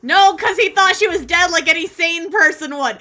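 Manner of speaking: laughing loudly while ranting; cracking up mid-rant. Real, not acted. Laughing rant about Carlos.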